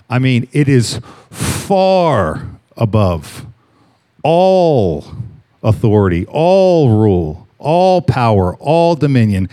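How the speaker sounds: each phrase spaced out slowly, all punched; slow emphatic list. Shown as a demonstration. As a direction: lay out every all, one by one, slowly. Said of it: "Those words are important here".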